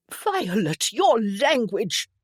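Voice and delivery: high, loud, drawn-out scolding; shouted reprimand. A narrator's reading. Mother to swearing daughter, shouted rebuke. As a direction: shout it in scandalised reproof. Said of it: "Eustacia shouted".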